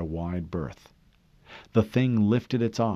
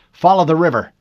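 loud, higher order; urgent order; 7 semitones higher, 11 dB louder, faster than usual. A narrator's reading. Harlan directing Fincher along the river, loud. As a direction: tell him where to drive, firmly. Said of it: "Harlan told him".

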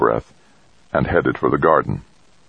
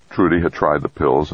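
usual speaking voice

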